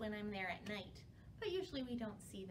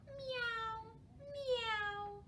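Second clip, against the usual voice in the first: high mewing meow; cat meow; 12 semitones higher than usual. Pretend. Librarian meows like a cat for children, high meow. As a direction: mew like a kitten.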